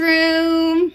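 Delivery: usual speaking voice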